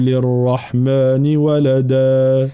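slow melodic tajweed chant; Quran recitation. Acted. Teacher recites. (تلاوة مجودة)

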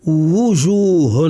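وجوه with a full, rounded w at the start, drawn out slowly; slow recitation demo of وجوه with clear waw; deliberate full waw. Shown as a demonstration. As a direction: open وجوه on a strong lip-rounded wu, long and careful. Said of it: "لابد أن نتكلف".

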